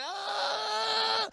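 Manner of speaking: long, high, loud scream; sustained comic shriek; imitated screaming. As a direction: scream at a monster in mock terror. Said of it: "She just starts screaming at".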